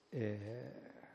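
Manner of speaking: ordinary talk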